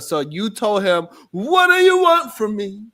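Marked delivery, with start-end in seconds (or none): 1.3-2.8 high, slow mock plea; mock pleading. Co-host quoting the man's plea back at him, mock pleading. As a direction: draw it out high, imitating his pleading.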